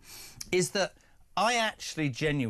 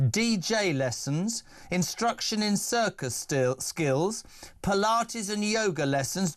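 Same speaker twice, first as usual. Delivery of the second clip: slow, spelled-out list with spaces between items; slow, deliberate; 3 semitones higher than usual. Real, not acted. Host slowly reads out the story to his guest, deliberate. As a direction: lay out each item slowly for emphasis. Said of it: "Let me just say this slowly".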